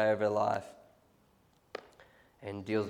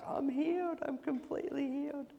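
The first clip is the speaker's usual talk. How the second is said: high, tearful retold voice of a healed man; mock tearful cry of joy; imitating crying joy; 5 dB quieter than usual. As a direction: voice the man crying on the phone, pitched way up. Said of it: "He's crying".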